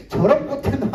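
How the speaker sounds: acted flash of irritation; mock road-rage outburst. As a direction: curse the driver who cut in. Acts out driver cut off.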